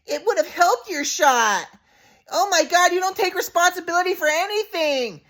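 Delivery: high falsetto mom scolding her son; mocking mom impression. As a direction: mimic mom high and scornful. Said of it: "My mom, I was like".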